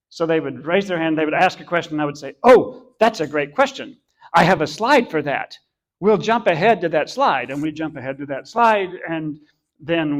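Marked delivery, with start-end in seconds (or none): 2.4-7.5 eager reply. Retold or imitated. Speaker answering a parent's question in class, eagerly. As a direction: imitate your own over-eager enthusiasm for a question.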